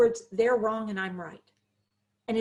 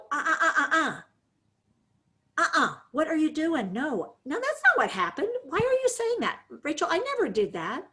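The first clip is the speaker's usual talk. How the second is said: defensive protest; 6 semitones higher than usual. Shown as a demonstration. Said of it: "those moments when we have that".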